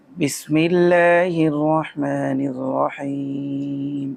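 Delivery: slow chanted Arabic recitation; tajweed-style chanting; melodic Quran recitation. Acted. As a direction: intone each phrase slowly and melodically. Opens class with Quranic recitation.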